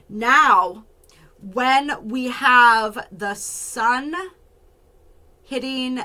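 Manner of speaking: very slow, stretched words with long pauses; talking really slow. Real, not acted. Astrologer hunting for the degree point, talking really slowly. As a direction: stall for time, drawing each word out. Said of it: "I know I'm talking really slow".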